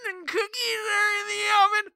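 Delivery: high, quavering grandma call. Acted. Grandma calling Bigfoot in from the woods, high and quavering. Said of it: "What's my grandma voice become?".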